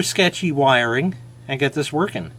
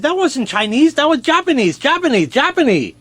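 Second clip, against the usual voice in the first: higher, louder protesting voice for the Chinese guy; insist, repeating Japanese higher and higher; indignant joke character; 11 semitones higher, 3 dB louder than usual. Acted.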